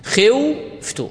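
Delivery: خوفت stretched very slowly with a silent و; teacher slowly sounding out خوفت; slow demo pronunciation. Shown as a demonstration. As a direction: draw out خوفت so the silent و is heard. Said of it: "الواو إذا سلبتها الحركة أصبحت ساكنة".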